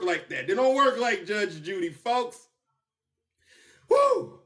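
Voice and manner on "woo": high, loud whoop. Real, not acted. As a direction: cheer out in glee. Celebrating the verdict.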